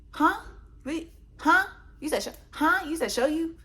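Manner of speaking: high, loud, incredulous huh; a sarcastic double take at his bluff; mock disbelief. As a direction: act baffled to mock his dare. Mocking his "show me.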